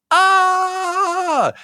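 a sudden high whoop of welcome; high-pitched party shriek; mock celebration yell. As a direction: cheer like the secret word was just said. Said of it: "we're all gonna welcome".

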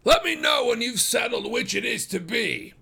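high, loud, mocking send-off; sardonic shout. A narrator's reading. Farmer calling after retreating suitors from the door, sardonic. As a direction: call it after them sardonically. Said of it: "he said sardonically".

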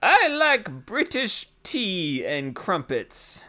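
high, slow put-on British accent, posh-joke style; mock British accent. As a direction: ham up a British accent to tease your British co-host. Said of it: "the British accent".

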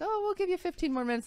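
lightly mocking a high, quick reassurance; mock sweet crew voice. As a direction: chirp it like a brisk staffer. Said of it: "they're like".

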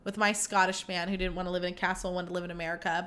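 low, quiet recalled lament; retold sadness. Podcaster retelling her sad castle wish, subdued. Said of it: "And I was so sad".

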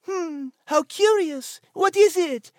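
intrigued French musing; French-accented curiosity. Acted. Nigel eyes the package, high French accent.

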